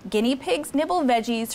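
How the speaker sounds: plain reading voice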